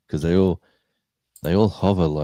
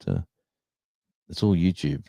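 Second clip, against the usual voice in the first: usual speaking voice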